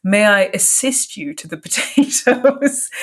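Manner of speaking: slow, over-polite phrasing, amused; mock formal student. Quoting a medical student at table, mock formal and laughing. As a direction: put on fussy politeness, breaking into a laugh. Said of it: "He insists on saying".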